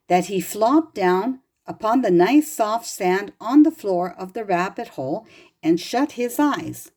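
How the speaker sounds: plain reading voice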